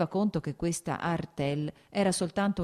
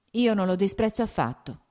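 ordinary delivery

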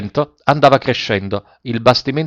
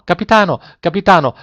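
desperate, higher, pleading call; frightened cry; 7 semitones higher than usual. A narrator's reading. Terrified passengers calling the captain, desperate cry. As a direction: cry out to him in panic. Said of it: "gridarono tutti insieme".

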